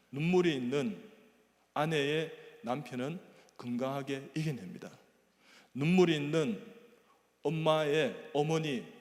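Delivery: loud, rising sermon refrain, slowed; preaching at full force. Acted. (힘차게 외치는 설교)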